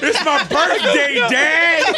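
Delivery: high, loud laughing whine. Pretend. Host mimics the whiny son.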